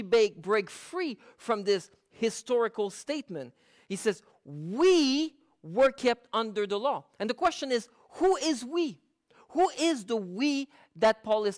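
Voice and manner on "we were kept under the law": "We" pushed, slow, the rest of the quote lighter; stress on we. Shown as a demonstration. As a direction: lean on "We" and read it slowly. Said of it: "I emphasize certain words".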